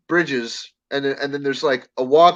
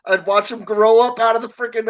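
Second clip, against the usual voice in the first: ordinary delivery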